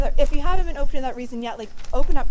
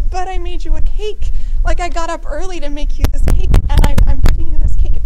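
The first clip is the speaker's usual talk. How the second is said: higher, louder mock pleading; pleading God; 6 semitones higher, 7 dB louder than usual. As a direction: play God protesting over a rejected gift. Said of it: "And God's like".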